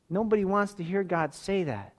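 ordinary delivery

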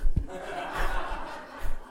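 breathy laugh without words; real laughter. Real, not acted. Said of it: "I laughed again".

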